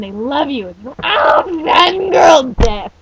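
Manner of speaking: loud squeal building to a drawn-out shriek; fangirl meltdown. Pretend. Fan imagines meeting every beloved voice actor, mock dying shriek. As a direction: scream in mock fangirl death.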